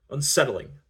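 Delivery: plain reading voice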